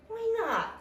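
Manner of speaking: high-pitched bird question; chirpy robin. A narrator's reading. Robin asking why Tortoise dislikes winter, chirpy. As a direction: ask it high and birdlike.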